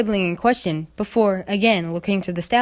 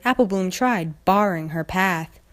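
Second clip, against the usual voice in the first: ordinary delivery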